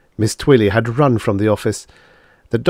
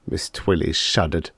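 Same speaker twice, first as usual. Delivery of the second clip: ordinary delivery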